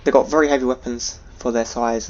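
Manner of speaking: ordinary delivery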